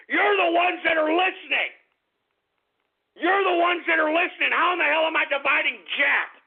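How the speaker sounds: high-pitched, indignant outburst; exasperated, heated comeback; angry protest. Real, not acted. Angry retort to a troll name.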